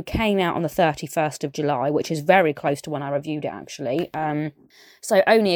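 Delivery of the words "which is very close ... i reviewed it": ordinary talk, nothing marked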